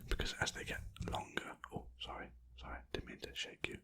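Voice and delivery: ordinary talk, nothing marked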